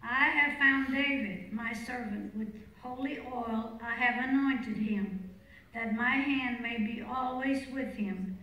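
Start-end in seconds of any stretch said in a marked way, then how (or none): none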